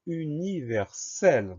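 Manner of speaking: slow, drawn-out syllables, pitched high; syllable-by-syllable dictation. Shown as a demonstration. Teacher introducing the next spelling word, slow syllable-by-syllable dictation.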